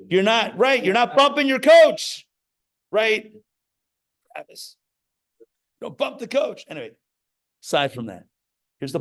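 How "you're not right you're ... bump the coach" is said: higher, louder teasing call-out; playful loud scolding. Real, not acted. Rabbi teasing a congregant about bumping the coach. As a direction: tease a listener loudly.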